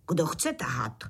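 usual speaking voice

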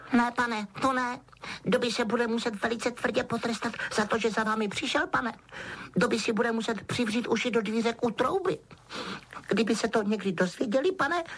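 high, anxious elf voice; Dobby, frightened house-elf. A narrator's reading. Elf trembling over his secret visit, anxious. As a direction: squeak in dread of punishment.

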